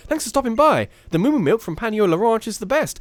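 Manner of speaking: hammed-up ranch milk seller. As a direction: pitch the product in a high character voice. Voicing a milk seller's dialogue.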